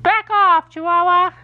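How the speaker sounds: high-pitched dog warning off a rival; Toby, mock alarm. Owner voices Toby defending his space, mock alarm. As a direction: raise the pitch, draw out the final words. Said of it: "He's, like, terrified".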